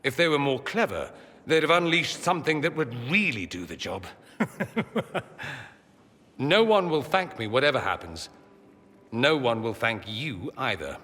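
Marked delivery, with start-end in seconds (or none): 4.4-5.6 light run of chuckles; wry chuckle. Acted. Joking about what would really do the job, wry chuckle. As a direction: laugh briefly at your own grim joke. Wry laugh after grim joke.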